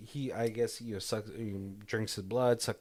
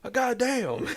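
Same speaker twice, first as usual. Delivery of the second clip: pained yelp; 13 semitones higher, 5 dB louder, slower than usual. Retold or imitated. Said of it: "he's like".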